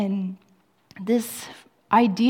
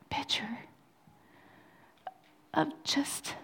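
whispered, halting and higher; ashamed, barely breathing the words; hushed confession; 4 semitones higher, 8 dB quieter than usual. Retold or imitated. A preacher reliving his confession to God, whispered.

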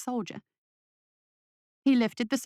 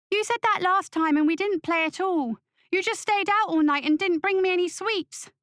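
put-on high-pitched complaining child; protesting boy; 9 semitones higher, 3 dB louder than usual. A narrator's reading. Son accusing father of broken promises, high whiny protest.

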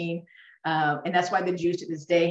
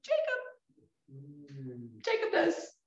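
excited answer; 3 semitones lower, 6 dB quieter than usual. Real, not acted. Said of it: "Who else".

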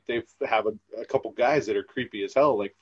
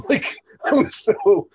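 laughing embarrassed, much higher and louder; 10 semitones higher, 6 dB louder, slower than usual. Real, not acted. Recalling being starstruck by a celebrity, laughing. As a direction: giggle through it, flustered.

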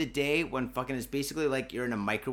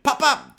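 loud high yelled pop pop; popcorn pops; 11 semitones higher, 11 dB louder than usual. Retold or imitated. Comic describing summer heat to listeners, loud popping noise. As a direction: shout the pops like popping corn. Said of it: "my nuts are like fucking popcorn".

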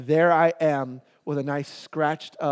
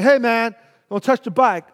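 louder, higher mock warning; called-out warning; 5 semitones higher, 6 dB louder than usual. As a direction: call out a retold warning to a kid. Bike owner calls out warning.

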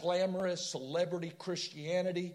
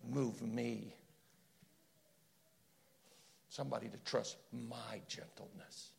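gentle divine reply; 5 semitones lower, 5 dB quieter than usual. Acted. Jesus explaining his ways to his friends, gentle and hushed. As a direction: answer tenderly, hushed, with long pauses. Voices Jesus answering, drops soft.

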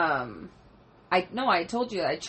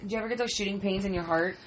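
normal talking voice

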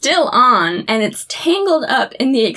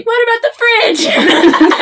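high, slow, louder laughing fret; mock worried; 12 semitones higher, 5 dB louder than usual. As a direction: fret over the fridge, laughing. Mocking worry over the fridge.

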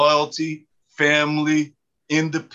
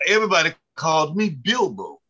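ordinary talk

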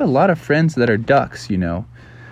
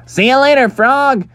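put-on high-pitched kids calling goodbye; taunting farewell in a squeaky kid voice; little duck kids; 12 semitones higher, 4 dB louder than usual. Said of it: "And they're like".

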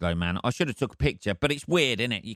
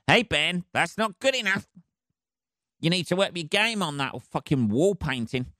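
higher, bossy voice of his own brain; his brain nagging him about the painting, higher and bossy; nagging inner voice; 6 semitones higher than usual. Retold or imitated. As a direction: scold yourself like a pushy coach. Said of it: "my brain was going".

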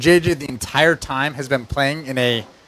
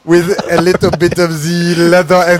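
put-on French accent; 4 dB louder than usual. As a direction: draw it out in a mock French accent. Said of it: "use a French accent with English words".

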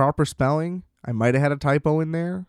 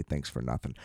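dropped low and much softer, a sarcastic aside; muttered sarcasm; 12 semitones lower, 14 dB quieter than usual. Retold or imitated. Quoting the line he never sent, a low sarcastic mutter. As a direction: mutter the sarcastic line under your breath. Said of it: "I wasn't even mean enough to say".

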